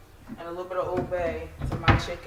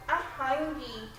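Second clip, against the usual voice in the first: put-on small, higher, slow child's whine; whiny kid voice; 6 semitones higher, 4 dB quieter, slower than usual. Mom imitating her daughter asking for food. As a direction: imitate a hungry little girl. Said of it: "My daughter like".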